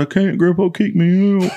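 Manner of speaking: a hammed-up sob-voice plea; mock crying. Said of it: "like, crying, dude".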